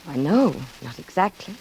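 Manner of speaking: measured narrator voice over music; reflective narration. A narrator's reading. First-person narration over music.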